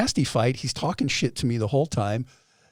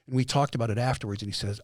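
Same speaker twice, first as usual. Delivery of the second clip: ordinary talk, nothing marked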